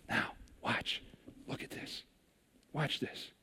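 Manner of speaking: unvoiced hush, leaning in close; whispered aside. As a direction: whisper it to the friends beside you. Jesus leans over to disciples.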